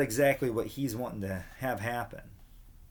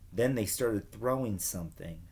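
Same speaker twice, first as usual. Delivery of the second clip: plain reading voice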